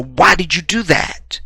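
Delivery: high, disbelieving mock outcry; shocked protest. Man suddenly shot by someone he knows, shocked protest. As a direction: cry it out high to someone you know. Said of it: "she shoots you and you go".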